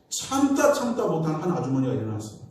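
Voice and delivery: usual speaking voice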